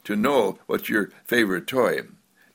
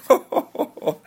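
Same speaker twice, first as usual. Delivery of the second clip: laughing. Retold or imitated. Baby answers laughing.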